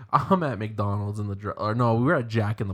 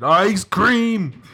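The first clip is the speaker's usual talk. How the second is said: high loud goofy scream; 9 semitones higher, 8 dB louder than usual. Retold or imitated. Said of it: "Keep screaming that shit".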